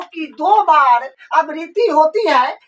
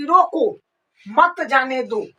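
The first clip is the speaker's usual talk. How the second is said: रोको, clear pause, then मत जाने दो falling lower; pause after रोको; 4 semitones lower, slower than usual. Shown as a demonstration. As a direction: break after रोको, keep मत जाने दो together. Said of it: "यहाँ पर जो कमा लगाएंगे". (रोको के बाद विराम)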